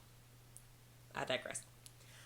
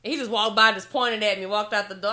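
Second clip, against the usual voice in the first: ordinary talk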